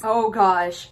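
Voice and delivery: ordinary delivery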